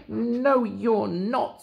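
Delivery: high defiant little voice; gingerbread man voice. Acted. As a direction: snap back in a tiny voice. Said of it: "said the gingerbread man".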